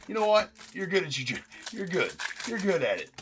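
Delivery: mock troll compliment. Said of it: "be the ultimate troll and be like".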